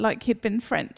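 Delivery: ordinary talk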